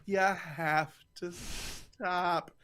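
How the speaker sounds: very slow, every word stretched; drawn-out mock plea. Reading a friend's spellings aloud, exaggerated drawn-out plea. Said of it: "how you write stop".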